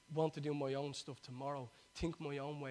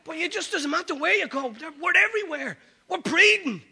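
high, very loud preacher's shout; roaring the sermon out to a whole congregation; fired-up preaching; 11 semitones higher, 16 dB louder than usual. Real, not acted. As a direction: preach it at full force, voice raised high. Preaches at full force.

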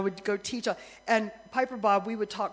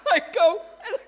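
laughing through the words; amused laughing retelling; 10 semitones higher, 7 dB louder than usual. Real, not acted. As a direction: giggle while telling it. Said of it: "laugh heard on line".

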